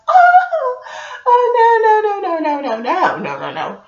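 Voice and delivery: very high wailing oh then repeated no, fading; panic-stricken squeal sliding down to a mutter; a terrified high-pitched protest that dies away; panicked wailing no. Retold or imitated. Acting out panic.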